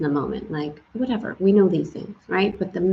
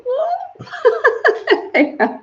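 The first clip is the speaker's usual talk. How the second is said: laughing; 4 dB louder than usual. Real, not acted. Laughing at cold sailing.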